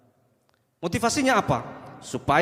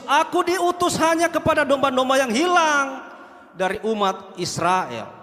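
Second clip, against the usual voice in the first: solemn voice of Jesus; 7 semitones higher, 4 dB louder, slower than usual. Acted. Voicing Jesus reading scripture.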